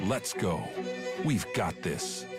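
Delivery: ordinary talk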